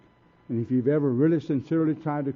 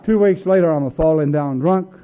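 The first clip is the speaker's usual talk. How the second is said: much louder, driving it home; loud, punchy payoff; loud punchline; 3 semitones higher, 11 dB louder than usual. Real, not acted. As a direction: hit the punchline hard.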